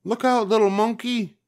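high, loud warning shout from the crowd; yelled warning. Acted. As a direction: call out to warn the monkey. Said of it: "someone yelled from the crowd".

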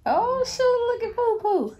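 very high, loud squeal; excited exclamation. Real, not acted. Exclaiming on spotting Poo Poo, high excited squeal.

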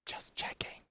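breathy sheepish whisper, barely voiced; playful whisper. Real, not acted. Host to guest after a silly passport question, whispered. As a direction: whisper it like a guilty aside.

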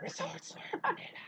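slow whispered announcement; Resorts World announcer. Retold or imitated. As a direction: parody an announcer, whispering and stretching the words. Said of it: "ikaw ba nagsasalita sa Resorts World?". (mabagal at pabulong na pag-aanunsyo)

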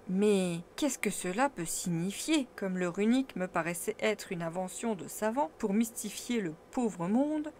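ordinary delivery, nothing marked

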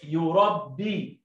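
يربي drawn out, very slow; slow blending. Shown as a demonstration. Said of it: "يبقى يو، رب، بي".

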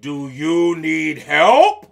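loud, slow offer swooping up at the end; big elephant voice. Acted. Elephant offering mosquito help, big and slow. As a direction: heave out each word as an elephant.

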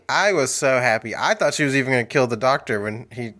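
usual speaking voice

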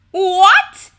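very loud, high cry of disbelief; shrieking. A narrator's reading. All the kobolds react to the news, shrieking. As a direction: shriek it out in shock. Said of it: "shrieked all the kobolds".

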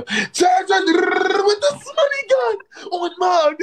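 high, drawn-out celebratory yell; slow, high-pitched victory howl; hype scream. Real, not acted. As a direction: explode into a long high shout. Breaks off to celebrate gift.

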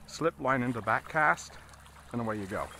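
ordinary talk

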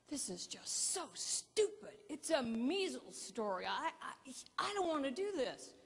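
mock complaint, dragging at first; reluctant grumble. Grumbling photographer, reluctant assignment.

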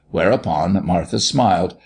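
ordinary delivery

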